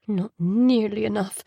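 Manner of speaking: plain reading voice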